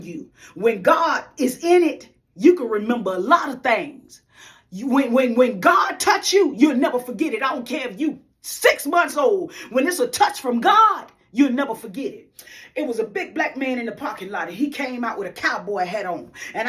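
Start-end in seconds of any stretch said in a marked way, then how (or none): 0.5-12.2 rising, louder preaching with slowed key phrases. Real, not acted. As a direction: preach it to the congregation, building fervor. Preaching about God's touch.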